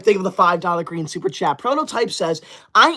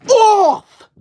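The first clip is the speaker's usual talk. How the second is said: sudden loud high yell; shriek; 12 semitones higher, 8 dB louder than usual. Real, not acted. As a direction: shriek it off the screen. Confetti covering the screen.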